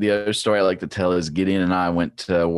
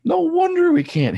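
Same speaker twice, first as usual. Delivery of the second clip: exasperated outburst; 10 semitones higher, 4 dB louder than usual. Retold or imitated. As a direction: rant it up high and loud, fed up. Frustrated rant about slow track.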